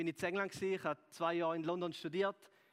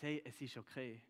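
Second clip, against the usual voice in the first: softer and gentle, a quiet different voice; tender reassurance; 3 semitones lower, 5 dB quieter than usual. Retold or imitated. Jesus looking listeners in the eyes, tender and softer. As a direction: speak it softly, as someone looking into their eyes. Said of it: "schaut euch in die Augen und sagt".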